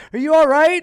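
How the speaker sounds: very high, loud concerned question; worried girlfriend. Retold or imitated. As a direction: ask in falsetto, worried. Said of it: "this girl's in ... my ear".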